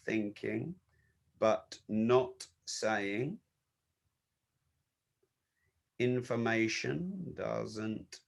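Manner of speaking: very slow, low and soft, to himself; murmuring while noting. Real, not acted. Speaker adding ideas to his content folder, murmuring slowly. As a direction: say it in fragments as if writing it down.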